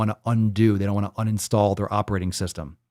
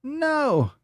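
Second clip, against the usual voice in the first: excited countdown; 13 semitones higher, much slower than usual. Real, not acted. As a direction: yell the final spins. Spinning wheel countdown.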